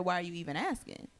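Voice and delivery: ordinary talk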